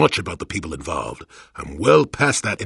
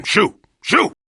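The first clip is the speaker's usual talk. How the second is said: sharp high shoo, much louder; cranky old man shooing a pest away; 13 semitones higher, 5 dB louder than usual. Acted. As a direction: chase them off with a loud shrill shoo.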